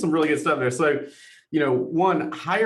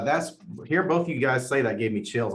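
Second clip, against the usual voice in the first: usual speaking voice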